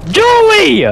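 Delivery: high, slow, loud outraged cry; outraged shout. Real, not acted. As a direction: yell the name in disbelief. Teammate shut the door on him.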